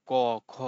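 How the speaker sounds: "ক, খ" made at the back of the throat; throat consonants. Shown as a demonstration. Teacher gives examples to students, "ক, খ" as guttural sounds. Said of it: "কণ্ঠ দিয়ে উচ্চারণ করি".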